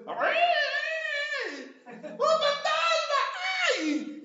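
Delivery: very high, loud, drawn-out shriek; hammed-up fright scream. Burly disciples see a ghost at sea, screaming in fright. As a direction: shriek in mock terror. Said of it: "gritando de susto".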